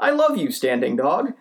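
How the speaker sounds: high, gushing dog voice dropping at the end; devoted pet declaring love; adoring dog. Acted. Dog to standing dog, adoring. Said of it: "he's just looking at me like".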